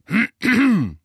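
loud, high throat-clear to break the silence. A narrator's reading. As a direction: clear your throat loudly. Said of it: "räusperte er sich laut".

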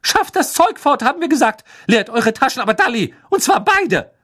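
high, loud furious scolding; angry shouting. A narrator's reading. Mother furiously ordering the twins to empty pockets. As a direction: rage at the twins like an outraged mother. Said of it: "rief Misses Weasley zornig".

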